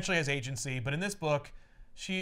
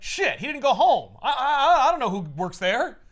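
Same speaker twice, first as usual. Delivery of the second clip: higher, louder flustered Goblin; mock panicked villain; 6 semitones higher, 7 dB louder than usual. Goblin caught out by Peter's move, flustered mock panic.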